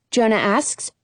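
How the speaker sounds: usual speaking voice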